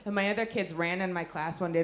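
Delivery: ordinary talk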